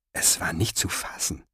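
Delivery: breathy hushed disbelief; whisper. A narrator's reading. Stunned thought in narration. (geflüstert)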